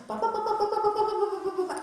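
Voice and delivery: high, rapid mock babble of a panicked friend; mock babbling. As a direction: imitate someone rambling on without listening. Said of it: "They just go".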